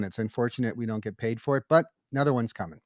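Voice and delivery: ordinary talk, nothing marked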